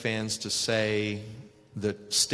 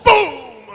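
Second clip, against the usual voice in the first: loud impact boom; 13 dB louder than usual. Retold or imitated. Elbow drop impact.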